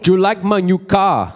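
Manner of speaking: slow, high mock-Scottish; Scottish accent. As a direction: imitate a Scotsman spelling it out slowly. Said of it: "a Scottish accent".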